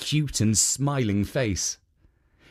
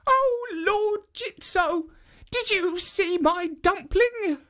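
put-on very high, slow old woman's plea; high, drawn-out old woman calling to the statue; slower than usual. A narrator's reading. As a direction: squeak it out like a frail old granny. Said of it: "The woman looked at the statue and said".

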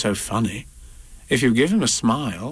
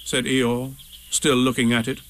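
plain reading voice

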